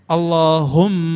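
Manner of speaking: Allahumma slow, the mm held long; tasydid demo. Shown as a demonstration. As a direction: stretch the doubled m of Allahumma. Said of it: "kalau ada tasdid begini".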